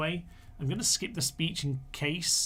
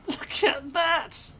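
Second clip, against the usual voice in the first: laughing out a much higher, slowed exclamation; laughing delight; 12 semitones higher, 4 dB louder, much slower than usual. Real, not acted. Gamer laughing at his slingers' volley, high and delighted. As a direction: crack up watching the slingers hit home.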